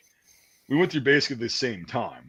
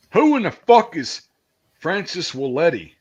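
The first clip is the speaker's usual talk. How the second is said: drill sergeant yelling; 6 semitones higher, 6 dB louder than usual. Retold or imitated. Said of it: "So the drill sergeant would be like".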